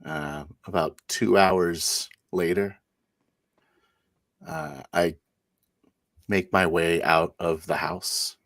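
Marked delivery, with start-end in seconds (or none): none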